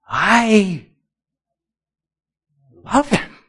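preacher exclaiming his love for God. Real, not acted. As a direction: declare it upward, full of feeling. Repeated declaration, raised.

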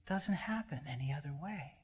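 a reverent whispered aside; hushed whisper. Real, not acted. Preacher whispering awe to the congregation.

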